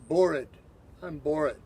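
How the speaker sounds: "Bored" said with a p and an added vowel, like "pored-uh"; Thai way of saying bored. Retold or imitated. Thai speakers answering a teacher, saying "Bored" as "pored-uh". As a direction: say "Bored" as "pore-ee", p for b. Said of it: "often hear type people say".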